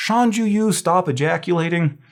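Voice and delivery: mock telling-off as a memory hook; mnemonic scolding. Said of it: "you'd be like".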